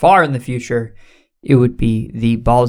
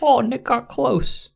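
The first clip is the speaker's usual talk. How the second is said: imitating her voice; 10 semitones higher, 4 dB quieter, slower than usual. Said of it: "she's like".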